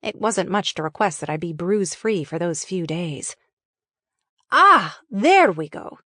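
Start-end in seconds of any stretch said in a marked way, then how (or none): 4.5-6.0 slow, pleased exclamation; a satisfied little cry, drawn out; exclaiming. A narrator's reading. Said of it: "she exclaimed".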